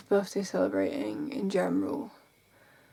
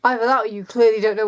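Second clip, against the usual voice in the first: loud, incredulous and sarcastic; disbelieving, raised voice rant; 3 semitones higher, 6 dB louder than usual. Real, not acted. Ranting at Instagram commenters.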